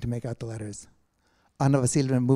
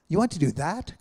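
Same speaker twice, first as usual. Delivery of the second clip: surprised cry, higher and louder; cried out; 5 semitones higher, 5 dB louder than usual. A narrator's reading. Anna startled by her friend's plan, crying out. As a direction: blurt it out in disbelief. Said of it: "cried Anna Vasilievna".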